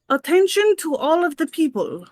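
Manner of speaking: loud, booming announcement; magically amplified voice. Acted. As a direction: project it over a crowd. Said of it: "to make my voice louder".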